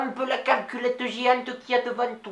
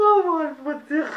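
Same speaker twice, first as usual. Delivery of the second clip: high, slow, louder cry of dismay; mock dismay; 6 semitones higher, 5 dB louder than usual. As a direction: drawl it out in shock. Reacts to the mishap. (consternation lente et aiguë)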